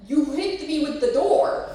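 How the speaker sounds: loud, slow, high protest; retold yell. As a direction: yell it back in mock outrage. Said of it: "And I'm like".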